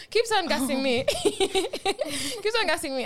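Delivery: laughing through the words; giggling repeat. Real, not acted. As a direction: repeat it while cracking up. Friend keeps pushing, laughing retelling.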